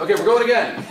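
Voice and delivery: loud set call. Retold or imitated. Director calling out on set.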